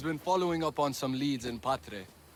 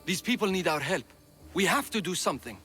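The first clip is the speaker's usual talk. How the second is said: rising from firm to a near shout, louder and higher; passionate plea; 8 semitones higher, 5 dB louder than usual. Acted. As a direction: push harder with every question, begging them to act. Pleading to save stranded people.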